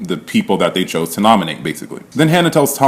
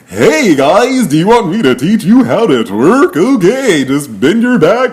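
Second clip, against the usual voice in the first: high, sing-song cartoon host; exaggerated kids'-show presenter, pitched way up; Raph; 10 semitones higher, 4 dB louder than usual. Mimicking a housemate's twerking tutorial, hammed-up cartoon voice. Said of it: "he's like a cartoon".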